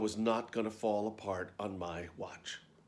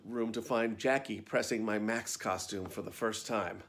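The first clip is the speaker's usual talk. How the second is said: ordinary delivery, nothing marked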